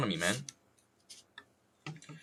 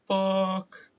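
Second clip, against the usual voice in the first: high, loud single burst; mock shouted curse; 11 semitones higher, 5 dB louder than usual. Streamer now allowed to say it, loud yelp. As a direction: belt out the swear word. Demonstrates swearing after thirty seconds.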